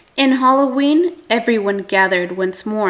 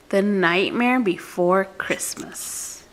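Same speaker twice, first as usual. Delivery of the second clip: hushed spooky title whisper; slow eerie whisper; 3 semitones lower, 5 dB quieter, slower than usual. Acted. Whispered dramatic book title.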